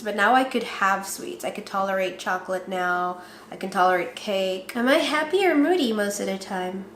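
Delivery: ordinary delivery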